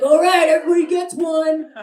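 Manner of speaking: loud hammed-up bro announcing the game; mock bro host. Party guy rallying his friends, loud hammed-up bro voice.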